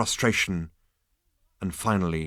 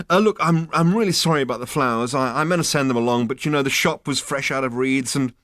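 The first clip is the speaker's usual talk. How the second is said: fast, high, flustered excuses; nervous babbling; 5 semitones higher, 5 dB louder, faster than usual. Acted. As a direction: gabble apologies in a panic.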